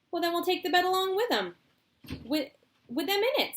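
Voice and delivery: high brisk eccentric voice; breezy solution, quick; Mister Wonka. Acted. Wonka proposes taking the bed along, breezy.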